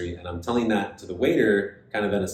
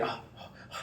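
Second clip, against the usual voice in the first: whispered flustered oh, oh, oh; 15 dB quieter, faster than usual. Retold or imitated. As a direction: breathe it out in a fluster. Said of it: "She was all flustered".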